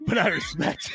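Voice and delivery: high squeal breaking into laughter; losing it laughing. Real, not acted. Host loses the accent mid-review, helpless laughter. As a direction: try to read on, crack up. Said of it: "I've lost it completely".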